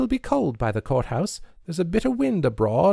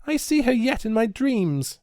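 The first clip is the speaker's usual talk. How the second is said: high, louder torrent of protest; words in a shower; 13 semitones higher, 4 dB louder than usual. A narrator's reading. Nancy railing at Mister Tibbet over burnings, high rushed outburst. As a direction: pour it out all at once. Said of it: "words falling from her in a shower".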